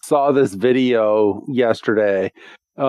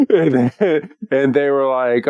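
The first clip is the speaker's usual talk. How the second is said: ordinary talk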